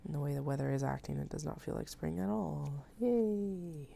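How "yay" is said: breathy sarcastic yay; mock cheer, dripping sarcasm. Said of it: "That is sarcasm".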